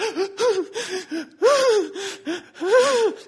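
high, loud hiccuping sobs; hammed-up convulsive gasping sobs; imitated sobbing gasps. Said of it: "I was literally sobbing".

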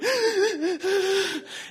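high, hiccuping mock sobs; hammed-up gasping cry of an inconsolable child; imitated sobbing. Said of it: "that's all I could do".

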